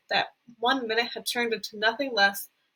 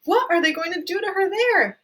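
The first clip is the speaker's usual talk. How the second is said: frightened; 9 semitones higher, 5 dB louder than usual. Acted. Mother frightened.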